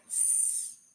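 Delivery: s as a held unvoiced "sss"; letter sound. Shown as a demonstration. Teacher gives the new sound, s as "sss". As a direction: make the sound of s, not "ess". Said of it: "change to a new sound".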